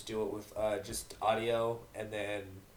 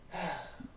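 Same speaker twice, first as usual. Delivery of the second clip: weary sigh; 7 semitones higher, 7 dB quieter than usual. Acted. Gray mare sighs.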